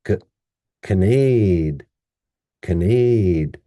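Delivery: "canade" as weak kuh, then long NADE; stressed second syllable. Shown as a demonstration. Teacher joining two syllables, "canade" with long stressed NADE. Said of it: "put those two together".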